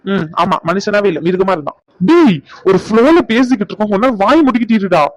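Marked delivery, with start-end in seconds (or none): 2.1-5.0 raised, annoyed telling-off; exasperated, higher-pitched hushing; irritated scolding. Acted. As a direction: cut off the interrupting friend, pitch jumping. Irritated speaker silences interrupting friend.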